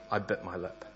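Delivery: normal talking voice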